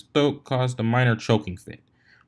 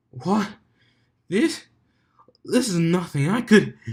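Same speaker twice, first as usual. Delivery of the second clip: much higher, straining, out of breath; breathless bravado; 8 semitones higher, slower than usual. A narrator's reading. Dumbbell brags to Soren while gasping for air. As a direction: fake confidence while gasping. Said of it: "he swallowed a moment".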